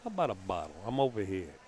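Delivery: thick New York bottle; New York accent. Shown as a demonstration. Commentator showing off an accent on air, New York accent. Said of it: "strongest New York accent".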